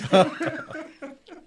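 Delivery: a loud, high burst of laughter at his own punchline; big amused laugh to close the tale; hearty laugh. Real, not acted. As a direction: laugh out loud, pleased with the story. After punchline of story.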